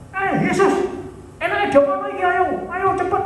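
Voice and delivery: high, pushy crowd voice urging Jesus; jeering crowd. Acted. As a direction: heckle him to hurry up. (suara orang banyak yang mendesak, tinggi dan tergesa)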